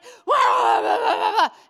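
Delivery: high, loud laughing shout; real loud laugh; loud burst of laughter. Real, not acted. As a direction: let a big laugh burst out. Laughing at mad people.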